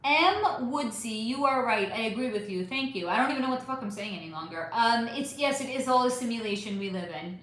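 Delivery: normal talking voice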